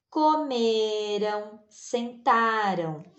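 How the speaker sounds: comeram and sentaram said slowly with a light tapped r; soft R demo. Shown as a demonstration. Teacher to class, showing the soft R slowly. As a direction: stretch out comeram and sentaram with a gentle r. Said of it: "É um R mais fraco".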